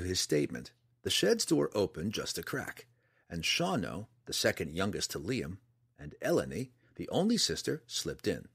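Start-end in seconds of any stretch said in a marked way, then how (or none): none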